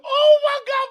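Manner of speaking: high, loud laughing cry; laughing shout. Real, not acted. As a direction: shout it while still laughing. Still recovering from laughter.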